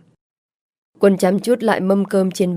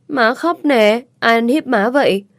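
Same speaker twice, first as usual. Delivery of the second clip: put-on higher, louder little boy; child's worried voice; 4 semitones higher, 5 dB louder than usual. A narrator's reading. Son sees mother crying, higher worried child voice. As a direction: play a small boy fretting over mom.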